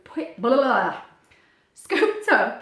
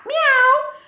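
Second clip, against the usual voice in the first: high, loud rising meow; cat meow; 9 dB louder than usual. Pretend. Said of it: "And a cat".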